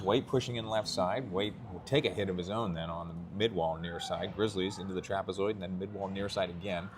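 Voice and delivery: hushed live hockey call; quiet play-by-play. Real, not acted. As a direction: call the game softly so the fans aren't disturbed.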